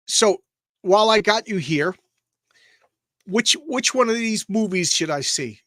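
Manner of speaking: ordinary delivery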